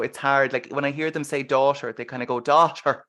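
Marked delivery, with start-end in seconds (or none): 2.4-3.0 put-on overdone Irish accent. Host mimics the film actors, overdone Irish accent. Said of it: "they're almost overdoing it".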